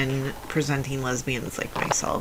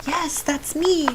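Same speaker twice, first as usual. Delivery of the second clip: much higher, slower, put-on feminine voice; high-pitched feminine voice; 13 semitones higher than usual. Answering the nurse calling her birth name, in a high-pitched feminine voice. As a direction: pitch it way up, dainty and slow, to sound girly. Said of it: "in my high-pitched feminine voice".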